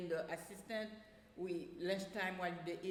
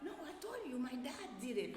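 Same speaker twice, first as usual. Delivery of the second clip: put-on high, childish voice for the boy's answer; a kid insisting, pitched up high; imitating a child; 7 semitones higher than usual. Child retold defending his haircut.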